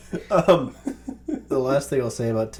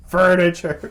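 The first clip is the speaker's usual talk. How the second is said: imitating a loud blurt; 6 semitones higher, 9 dB louder than usual. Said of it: "shook it and said".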